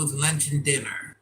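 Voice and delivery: ordinary delivery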